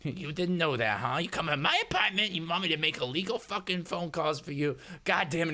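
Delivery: high-pitched, agitated rant; exasperated hacker. Acted. Hacker fed up with a stranger's illegal request, high exasperated rant. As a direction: build from sarcastic doubt to cursing frustration.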